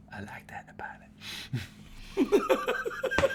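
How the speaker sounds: hushed whisper that breaks into a laugh; whispered movie line. Retold or imitated. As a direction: breathe the line, then crack up. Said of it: "He goes".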